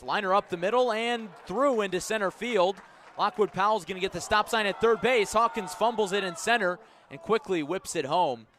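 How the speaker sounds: urgent shout on the throw home; shouted excited call. Real, not acted. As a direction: rise to a shout as the ball gets away. Live call, hit to center.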